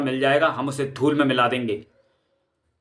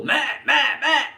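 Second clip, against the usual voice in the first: squabbling; 11 semitones higher than usual. Acted. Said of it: "तू तू मैं मैं".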